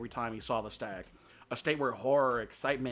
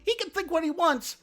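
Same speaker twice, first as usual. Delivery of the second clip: much higher and louder, near a scream; frightened retort; 5 dB louder than usual. A narrator's reading. Feline snapping back at Bambi, high panicked cry. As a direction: push it up into panic. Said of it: "went on screaming".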